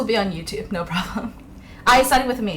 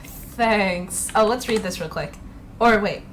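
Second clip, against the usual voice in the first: usual speaking voice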